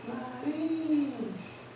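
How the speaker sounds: slow, quiet demo of "nariz" with a lingering RIZ; stress on the RIZ of nariz. Shown as a demonstration. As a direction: stretch out the last syllable of "nariz". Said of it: "qual a sílaba mais forte ou a sílaba tônica".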